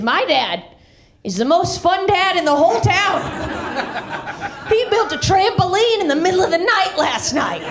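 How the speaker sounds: put-on high, excited kid bragging about his dad. Kid bragging to friends about his fun dad, excited. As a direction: gush like a proud little kid.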